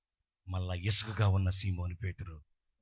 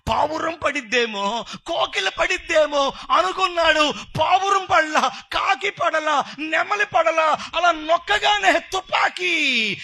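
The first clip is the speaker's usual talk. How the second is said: full-force preaching; 11 dB louder than usual. Real, not acted. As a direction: preach it at the top of your voice. Preacher tells parable at full force. (గొంతెత్తి ఉద్వేగంగా ప్రసంగం)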